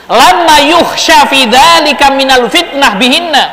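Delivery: measured kitab reading; Arabic text recitation. Acted. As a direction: recite the classical text slowly.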